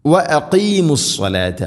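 high, slow tajweed chant; Quranic recitation. Shown as a demonstration. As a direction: recite it slowly as a model. Quran teacher models the recitation.

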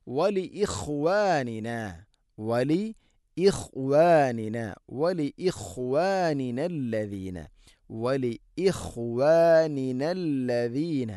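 ഇഖ്വാനിന drawn out slowly with both nuns clear; slow careful recitation. Shown as a demonstration. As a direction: recite വലി ഇഖ്വാനിന slowly, every letter heard. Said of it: "രണ്ട് നൂനാണ്". (ഇഖ്വാനിന സാവധാനം വ്യക്തമായി ഉച്ചരിക്കുക)

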